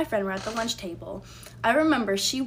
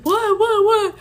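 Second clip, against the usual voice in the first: much higher, louder whiny what what what; mocking boyfriend's protest; 11 semitones higher, 8 dB louder, slower than usual. Voicing the boyfriend after she slapped him, high mock protest. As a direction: play the cornered boyfriend squeaking back. Boyfriend voiced during slap fight.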